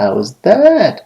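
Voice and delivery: high-pitched, proud gloat; proud taunt. Acted. Man who just landed a hit, proudly taunting. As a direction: show off proudly after landing a blow. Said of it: "فخر سے بولا".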